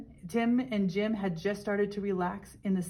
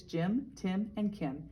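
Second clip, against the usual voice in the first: usual speaking voice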